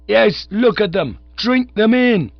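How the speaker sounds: loud, high ranting soapbox yell; yelling orator. A narrator's reading. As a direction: bellow it at the crowd. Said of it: "he was yelling".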